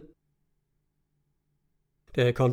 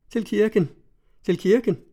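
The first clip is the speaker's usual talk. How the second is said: higher, eager calling toward the church; excited urging; 6 semitones higher than usual. A narrator's reading. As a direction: call her groom onward, lifted and eager. Mad bride urging to church. (ivrigt, højere kaldende)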